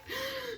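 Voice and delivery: high sharp sucked-in gasps; acted-out hyperventilation; hyperventilating gasp. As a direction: imitate gasping for air while sobbing.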